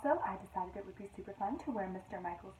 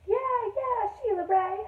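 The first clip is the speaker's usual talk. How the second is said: high, loud cheer from the crowd; cheering yell; 12 semitones higher, 10 dB louder than usual. Acted. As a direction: cheer her on like a pack of friends. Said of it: "her friends yelled".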